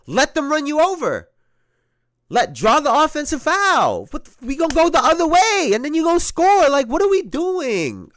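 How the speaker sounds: high, loud frustrated shout at a teammate; exasperated yelling at player. Retold or imitated. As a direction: yell it like you can't believe what he's doing. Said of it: "I'm like".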